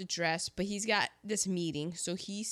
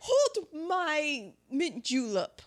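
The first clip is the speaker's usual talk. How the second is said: high, drawn-out Southern-belle boast; hammed-up daredevil belle; mock bravado; 8 semitones higher, 4 dB louder, slower than usual. [name] outdoing Scarlett, mock bravado.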